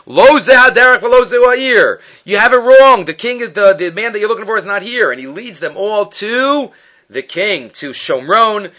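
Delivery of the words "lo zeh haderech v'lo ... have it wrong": loud, high announcing; commanding call. Retold or imitated. Elisha calling out to blinded army, loud commanding. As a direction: call out to the lost soldiers.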